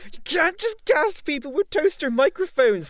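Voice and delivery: high, loud, slow meme rant; outraged Boomer. Acted. Actor parodying Boomer meme at casting director, outraged. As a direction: complain loudly as a caricature Boomer. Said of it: "the Boomer's like".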